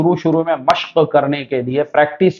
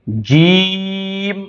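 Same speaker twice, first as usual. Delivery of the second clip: جیم stretched very slowly; drawn out; 4 semitones higher, much slower than usual. Shown as a demonstration. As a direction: hold جیم long and steady. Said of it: "کھینچ کے پڑھ رہا ہوں". (جیم کو خوب کھینچ کر پڑھنا)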